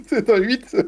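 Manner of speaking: laughing through the words. Real, not acted. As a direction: chuckle while admitting it. Confirms a bad roll, laughing.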